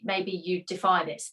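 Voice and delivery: usual speaking voice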